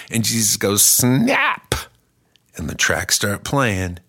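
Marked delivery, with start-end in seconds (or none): 0.8-1.8 squeaky high snap sound. Acted. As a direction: make a tiny high snap. Jesus snaps fingers vocally.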